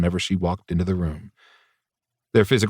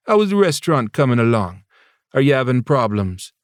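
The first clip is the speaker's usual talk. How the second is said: put-on Jamaican accent; 5 semitones higher than usual. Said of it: "her lyrical Jamaican accent".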